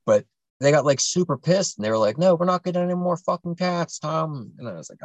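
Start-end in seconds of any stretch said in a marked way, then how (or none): 2.2-4.5 mock furious parent, higher; a parent snapping back in anger; angry yell. Parent refusing another cat, angry yell.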